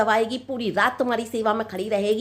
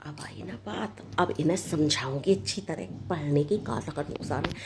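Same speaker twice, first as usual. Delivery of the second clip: much lower and softer, to herself; scheming aside; 8 semitones lower, 7 dB quieter than usual. Acted. Bride plotting to herself, low and soft. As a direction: mutter it aside like a plot.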